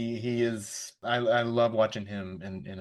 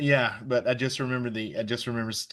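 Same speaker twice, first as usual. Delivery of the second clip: normal talking voice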